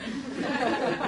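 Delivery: high, loud laugh; loud burst of laughter. Real, not acted. Speaker laughing at his imagined retort, real laugh. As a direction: crack up at your own line.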